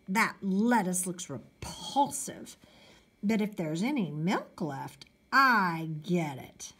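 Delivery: plain reading voice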